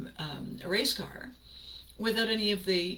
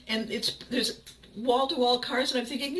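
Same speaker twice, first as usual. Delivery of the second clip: ordinary talk, nothing marked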